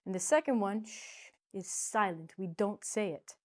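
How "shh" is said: a drawn-out shushing sound; hushing shh. Shown as a demonstration. Teacher showing students a silent vowel, hushing shh. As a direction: hush it, as for silence.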